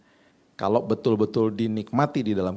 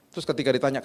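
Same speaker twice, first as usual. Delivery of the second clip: ordinary talk, nothing marked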